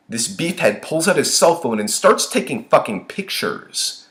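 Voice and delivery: usual speaking voice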